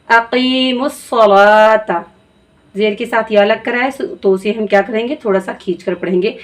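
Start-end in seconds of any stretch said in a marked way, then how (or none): 0.1-2.0 अकीमुस सलाता very slow, वाव silent, ला stretched; slow Quranic recitation. Shown as a demonstration. Teacher slowly recites अकीमुस सलाता for students. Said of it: "सिर्फ लाम पढ़ा जाएगा।".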